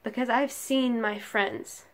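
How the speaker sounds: emphatic recital, hitting key words hard; forceful slam-poetry delivery; impassioned spoken-word poem. Acted. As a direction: deliver each phrase as an indictment, pausing between. Poem builds to accusation.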